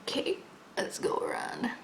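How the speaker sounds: whispering. Real, not acted. Whispering to herself while working.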